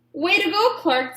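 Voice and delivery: cheering friends. A narrator's reading. Friends congratulating Clark, cheering. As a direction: cheer for your buddy. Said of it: "said his friends".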